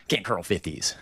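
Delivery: slow, low mocking mumble; mocking mutter. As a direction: mutter it back in scorn. Mocking the chat.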